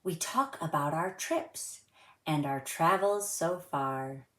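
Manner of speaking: gentle sing-song storybook reading; reading to children. A narrator's reading. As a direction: read it like a rhyme for little kids. Reads a rhyming picture book aloud.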